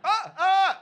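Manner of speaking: loud high panicked scream; retold scream. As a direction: shriek in sudden horror. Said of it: "I screamed".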